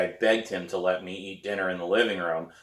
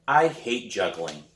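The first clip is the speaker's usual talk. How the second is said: ordinary delivery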